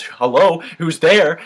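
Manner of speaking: freaked-out call. Acted. Spooked bar patron calling out to nobody, loud and freaked out. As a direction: shout it, spooked and searching. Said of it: "he gets totally freaked out".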